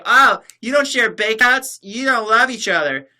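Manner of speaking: high, loud sneering mock voice; mock chick. Streamer mocking women who judge couples, high mock voice. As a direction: sneer it like a judgy girl. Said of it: "chicks who say".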